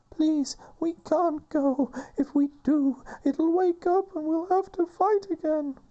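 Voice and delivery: very high, slow and loud pleading; high girl voice. Acted. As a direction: beg them to turn back in a high voice. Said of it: "Shanna's talking".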